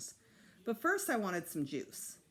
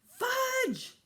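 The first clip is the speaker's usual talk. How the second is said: much higher, loud alarmed shout of a name; 7 dB louder than usual. A narrator's reading. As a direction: yell the boy's name in shock. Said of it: "Then she shouted".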